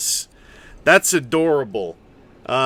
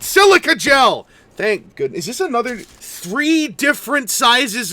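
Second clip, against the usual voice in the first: excited; 8 semitones higher, 5 dB louder than usual. Real, not acted. Finding the silica gel packet.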